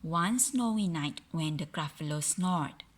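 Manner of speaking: ordinary delivery, nothing marked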